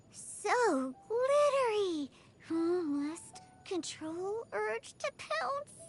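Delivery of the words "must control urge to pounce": slow, soft, rising effort to hold back; hushed, halting self-control; straining self-restraint. Acted. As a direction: force it out slowly, fighting temptation. Cat girl resisting shiny treasure.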